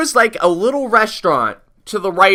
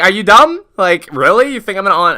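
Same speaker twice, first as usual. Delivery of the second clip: higher, louder scornful questions; retold scoffing comeback; incredulous retort; 6 semitones higher, 5 dB louder than usual. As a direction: throw it back in disbelief.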